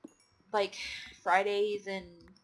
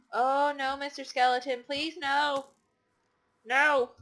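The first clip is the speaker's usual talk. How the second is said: loud, higher mock-panicked cry; panicked pleading; 5 semitones higher, 8 dB louder, slower than usual. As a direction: plead loudly with the monster to stop. Pleading with attacking game enemy.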